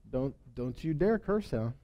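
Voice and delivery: a quiet threat that means it; hushed warning. Retold or imitated. God warning Lavan in a hushed stern voice.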